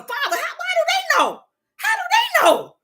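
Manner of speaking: asking the Father in prayer, high and pleading. Pretend. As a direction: cry up to God in baffled wonder. Pleading to God, puzzled.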